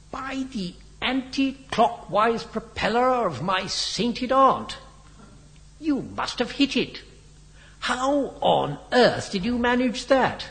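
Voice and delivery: high-pitched exasperated amazement; almost shouting. A narrator's reading. Biggles demands an explanation from Henry, almost shouting. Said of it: "almost shouted Biggles".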